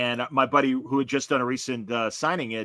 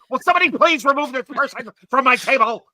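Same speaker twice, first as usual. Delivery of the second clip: high, loud mock-outraged appeal to the whole room; screaming; 11 semitones higher, 9 dB louder than usual. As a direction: imitate a stranger screaming for help evicting someone. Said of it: "he's screaming at me".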